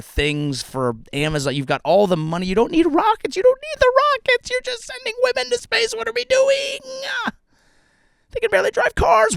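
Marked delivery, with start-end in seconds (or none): 2.5-3.4 much higher mocking falsetto rant. Mocking Bezos about rockets in a much higher mocking voice. 3.4-4.5 much higher falsetto on rockets; high squeak. Pretend. Mocking Bezos about rockets, much higher falsetto take. 4.5-9.4 much higher mocking falsetto rant. Mocking Bezos about rockets in a much higher mocking voice.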